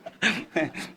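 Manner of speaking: quiet chuckle at his own joke; a soft laugh to himself; brief amused chuckle; short laugh. Real, not acted.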